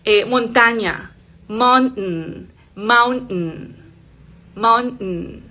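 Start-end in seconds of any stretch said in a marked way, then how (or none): 1.5-5.4 "mountain" with a stopped T and nasal n; glottal T. Shown as a demonstration. Teacher giving another example word, glottal T. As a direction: cut "mountain" in the throat, release through the nose. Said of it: "Detenida, glotal".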